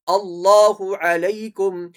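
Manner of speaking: loud slow chant with a heavy l in اللہ; full, thick Allah. Shown as a demonstration. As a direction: make the lam of اللہ thick and drawn out. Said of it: "اللہ کا نام موٹا پڑا جائے گا". (اللہ کا لام موٹا کر کے پڑھنا)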